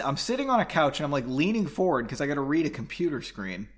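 ordinary delivery

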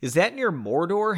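ordinary delivery

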